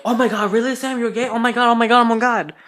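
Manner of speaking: high, loud shocked squeals; flipping out. Retold or imitated. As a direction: shriek it like a stunned crowd. Everybody flips out on learning.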